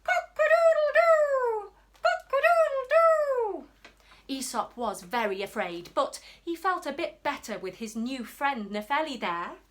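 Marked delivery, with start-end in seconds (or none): 0.0-3.7 high, loud rooster crowing. Acted. Rooster on the cave roof crows a terrible sound. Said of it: "making a terrible sound".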